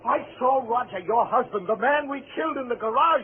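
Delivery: high, rushed, panicked confession; frightened lover pouring out his guilt to Helen; hysterical. Acted. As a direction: blurt it out close to breaking. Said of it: "I didn't mean to become hysterical".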